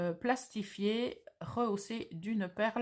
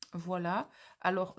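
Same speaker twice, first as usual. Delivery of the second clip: ordinary talk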